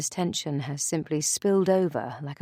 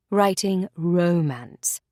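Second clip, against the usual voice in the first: romance drawn out slowly and leaned on; emphasis on romance; slower than usual. A narrator's reading. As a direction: lean hard on "romance", slowly. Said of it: "with a notable emphasis on the word".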